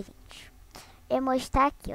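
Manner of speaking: ordinary delivery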